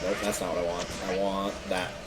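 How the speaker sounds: ordinary talk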